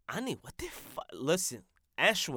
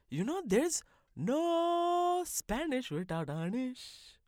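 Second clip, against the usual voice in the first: much higher, slow, put-on voice; 9 semitones higher, 3 dB louder, slower than usual. Host delivering a pun in a silly voice. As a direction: sell the pun in a funny voice.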